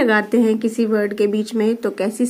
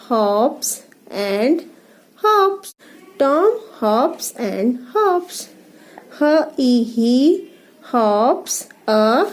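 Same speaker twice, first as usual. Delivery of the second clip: slow reading, word by word; paragraph reading; much slower than usual. Shown as a demonstration. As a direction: read each word separately for young learners. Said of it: "ये paragraph read करेंगे". (एक-एक शब्द धीरे पढ़ना)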